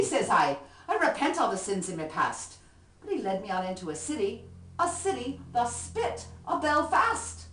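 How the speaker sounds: lively Irish ballad recitation, rhythmic; poem recitation. Acted. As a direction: perform the comic poem with swing.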